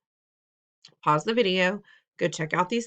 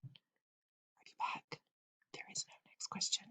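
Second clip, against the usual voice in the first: slow, teasing whisper to the class; playful whisper; 17 dB quieter than usual. Acted. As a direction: whisper it like a secret joke. Teasing students after last question.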